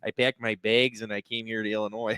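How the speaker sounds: Upper Midwest accent, "bags" like "baygs"; demonstrated South Dakota accent. Shown as a demonstration. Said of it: "That's the accent I'm talking about".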